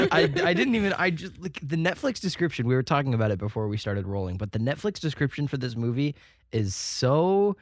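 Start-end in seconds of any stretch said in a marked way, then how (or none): none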